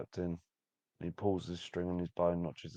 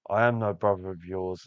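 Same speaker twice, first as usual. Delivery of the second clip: firm, louder declaration; cold defiance; 8 dB louder than usual. Acted. Archer answers the pleading captain, firm defiance. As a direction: disown him with force.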